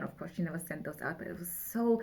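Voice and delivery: normal talking voice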